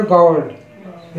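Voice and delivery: गार्ड drawn out very slowly, long aa; teacher stretches out गार्ड so students hear the aa; model pronunciation. Shown as a demonstration. Teacher to students, slowly modelling the word. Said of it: "सही जो आवाज़ है इसकी गार्ड पढ़ी जाएगी".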